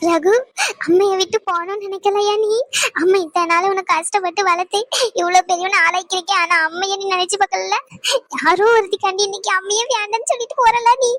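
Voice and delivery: tearful reproach. Acted. Mother to son who left her for someone, tearful reproach. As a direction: plead through sobs, hurt and reproachful. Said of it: "அழுகாதீங்கம்மா".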